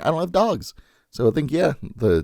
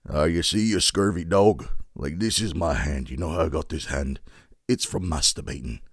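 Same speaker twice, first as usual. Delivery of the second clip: put-on scurvy old sea-dog pirate accent; weird pirate accent; 5 semitones lower than usual. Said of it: "I went that weird accent".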